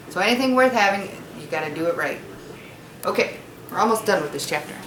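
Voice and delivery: normal talking voice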